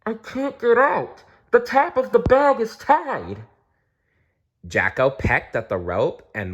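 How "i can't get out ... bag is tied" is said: scared muffled voice. A narrator's reading. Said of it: "A scared and muffled voice called back".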